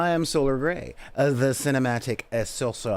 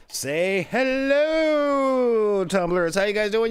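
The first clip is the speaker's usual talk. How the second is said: a stretched, much higher call to say hello; 9 semitones higher, 4 dB louder, slower than usual. Acted. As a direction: drag it out like a ring announcer. Host calling out to players.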